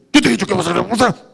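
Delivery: a customer snapping at the cashier; 1 cliente grosero. Retold or imitated. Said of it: "le dice". (cliente grosero que responde rápido y fuerte)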